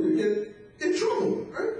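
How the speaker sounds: ordinary talk, nothing marked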